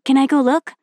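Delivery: put-on bright pleading child voice; excited girl voice.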